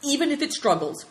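high, firm declaration; resolute. Acted. Big Tiger insisting on his plan, resolute. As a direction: answer the doubt with resolve. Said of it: "declared Big Tiger resolutely".

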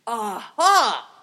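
loud, triumphant roar; big booming exclamation. A narrator's reading. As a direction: roar it out in sudden realisation. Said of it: "roared Thunderbolt".

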